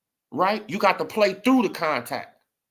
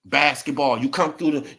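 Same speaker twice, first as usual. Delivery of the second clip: usual speaking voice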